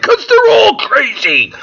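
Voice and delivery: high, loud, slow mocking dad voice; smug dad. Dad answering his son at the barbecue, loud and smug. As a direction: drag it out like a sneering father. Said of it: "And Dad's like".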